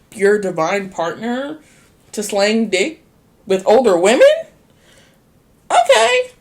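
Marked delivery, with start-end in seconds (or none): 5.7-6.3 high, loud incredulous okay; scoffing okay; sarcastic okay. Real, not acted. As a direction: shoot way up in disbelief. Sarcastic reaction.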